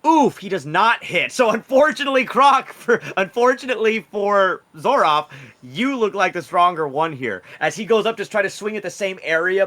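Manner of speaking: loud, high dramatic play-by-play; excited game master. Real, not acted. Game master narrates Zoroff's missed swing, excited. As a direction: call the failed attack with big energy.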